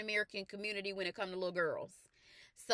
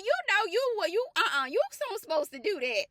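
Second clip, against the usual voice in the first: higher and louder, a mock scolding dad; imitating the dad scolding; 9 semitones higher, 4 dB louder than usual. The dad correcting Coach Jess outside the store, high mock scolding. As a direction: lecture her like the dad who caught her. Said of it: "He said".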